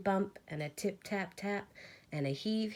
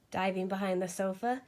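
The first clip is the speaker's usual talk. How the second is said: usual speaking voice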